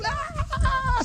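high mock shriek of a soccer mom; screaming mom. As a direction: imitate a mom losing her mind. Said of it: "she was".

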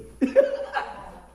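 real high laugh. Real, not acted. Laughs at doctor's joke.

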